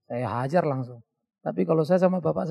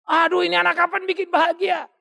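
high, loud despairing parent cry; wailing parent; 11 semitones higher, 4 dB louder than usual. Retold or imitated. Preacher voices a weary parent asking when joy comes. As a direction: moan in mock despair.

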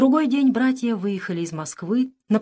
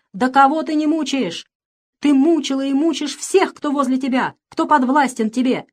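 loud, higher-pitched furious cry; outburst, voice breaking; 6 semitones higher, 5 dB louder than usual. A narrator's reading. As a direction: cry out the accusation, overwrought. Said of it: "Он выкрикнул сорвавшимся голосом".

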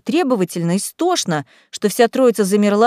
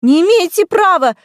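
much higher, louder shrieked protest; 9 semitones higher, 6 dB louder than usual. A narrator's reading. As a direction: scream it after the guards. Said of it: "взвизгнула Бьюти".

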